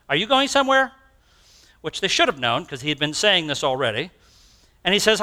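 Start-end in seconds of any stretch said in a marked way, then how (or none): 0.1-0.9 high, alarmed question; puzzled question. Retold or imitated. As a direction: ask as if it just dawned on you. Disciples puzzled by Jesus leaving.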